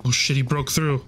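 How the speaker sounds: normal talking voice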